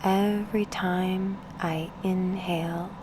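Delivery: unhurried meditation guide, with long pauses between phrases; guided meditation. Acted. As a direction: ease the listener into stillness, spacing out each instruction.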